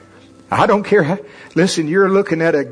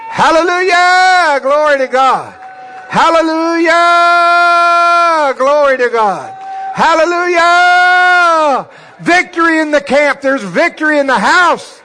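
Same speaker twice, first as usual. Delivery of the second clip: high, loud shouted hallelujah; roaring praise; 14 semitones higher, 7 dB louder than usual. Real, not acted. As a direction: roar it up to the rafters.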